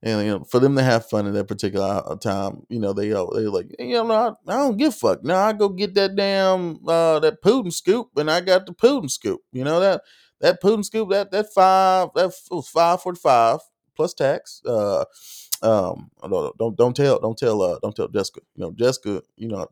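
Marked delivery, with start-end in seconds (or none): 3.8-14.6 higher mock Southern good-ol'-boy twang. Country buddy boasting about his buy, in a higher mock Southern twang. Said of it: "like a Savannah accent".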